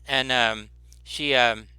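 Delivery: ordinary talk, nothing marked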